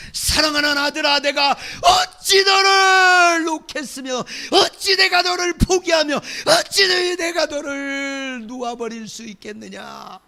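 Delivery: God pleading with his beloved son, crying out; tearful plea. Acted. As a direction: beg as a heartbroken father.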